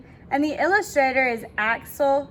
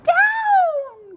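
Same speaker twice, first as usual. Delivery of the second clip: very high, loud drawn-out call; long high-pitched shout; witch's cry; 7 dB louder than usual. A narrator's reading. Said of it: "cried the witch".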